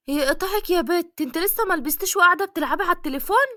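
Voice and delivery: high, fast scolding of a lazy daughter; scolding mother. Acted. Mother scolding her daughter for still being on the phone, high and fast.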